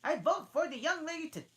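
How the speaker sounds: high, loud put-on voice, weary; a fed-up Hare demanding a story; March Hare, bored and grumpy. March Hare yawning at the tea party, high and loud.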